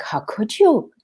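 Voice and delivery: high, appalled teacher's protest; horrified teacher. Retold or imitated. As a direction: act out the shocked music teacher. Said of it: "She was like".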